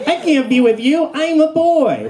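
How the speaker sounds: put-on high panicky boy squirrel. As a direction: squeak out the protest. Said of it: "he's like".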